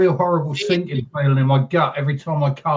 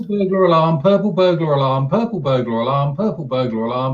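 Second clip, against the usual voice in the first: "purple burglar alarm" rattled off over and over; said fast repeatedly. Shown as a demonstration. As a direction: rattle the phrase off quickly. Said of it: "Same twice fast".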